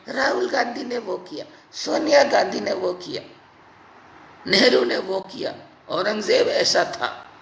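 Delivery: sneering imitation of armchair gossips; lightly voiced, mocking chatter; a hint of a sneering know-it-all; mock bhakt gossip. Mocking what right-wingers say.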